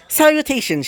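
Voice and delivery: bright, high and chipper hello; cheery greeting. A narrator's reading. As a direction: greet them like a delighted robot girl. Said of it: "she would give off a cheery tone".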